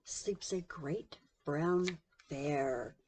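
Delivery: lively picture-book read-aloud; reading to children. A narrator's reading. Narrator reading a bedtime story to children, lively. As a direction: tell the bedtime story with big voices.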